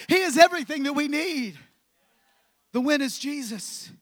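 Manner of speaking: emphatic, rising sermon chant; full-force preaching. Acted. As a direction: pound each line home from the pulpit.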